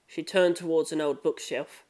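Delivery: plain reading voice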